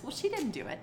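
ordinary delivery

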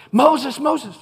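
loud, high-pitched urgent summons; calling out with sudden urgency. Acted. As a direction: shout the name twice to grab attention. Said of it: "I think it was urgency".

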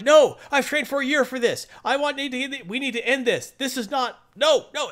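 high, urgent protest; high eager hero voice; eager insistence. Retold or imitated. Spider insists on charging in.

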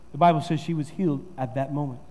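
ordinary delivery

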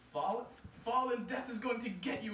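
urgent warning; answering. Acted. Anansi to daughter, high warning. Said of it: "Anansi answered".

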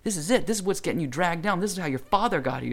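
high, loud retold tirade; imitated yelling. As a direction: lay into the kids like a fed-up adult. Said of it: "He's, like, yelling at these kids".

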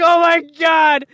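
high, drawn-out mock shout; mock panicked cry. Recruit reacting to the sergeant's tirade, mock panicked cry. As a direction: imitate a recruit crying out in dread.

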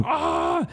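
high-pitched "aah" of retold alarm; mock startled scream. Storyteller reliving seeing the stick in the arm, mock scream. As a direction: reenact a startled, high cry.

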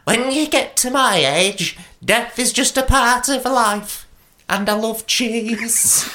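put-on high, unhurried old-lady voice; calm elderly woman. A narrator's reading. Elderly woman answering Girth over her sandwich, calm old lady. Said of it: "calmly answered".

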